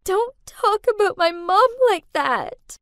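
high, tearful wail; upset, crying voice; sobbing protest. A narrator's reading. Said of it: "Sobbing, Kath replied".

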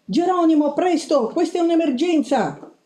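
high, urgent shriek; shrill excited sister calling in an emergency; Tea. A narrator's reading. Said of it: "strillò mia sorella Tea".

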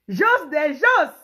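loud, high, slow calling out; drawn-out call. Acted. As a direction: call his name out long and loud. Voicing a woman calling a suitor. (appel traînant et fort)

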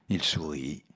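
plain reading voice

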